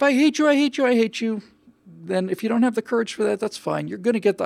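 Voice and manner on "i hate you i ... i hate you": mock whiny child yelling, high and louder; child's tantrum. Imitating a child yelling at the parent who says no, mock tantrum. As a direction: imitate a kid shrieking at a parent. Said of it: "the hour of".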